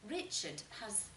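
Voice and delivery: ordinary delivery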